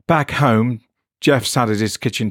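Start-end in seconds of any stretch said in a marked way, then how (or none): none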